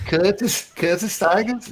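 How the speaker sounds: squeaky attempt at a Liverpool accent; bad Liverpool accent. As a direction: do a terrible high-pitched Scouse impression. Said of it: "a really bad Liverpool accent".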